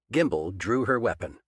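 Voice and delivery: usual speaking voice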